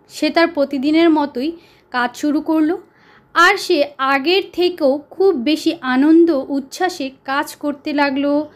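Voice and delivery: ordinary delivery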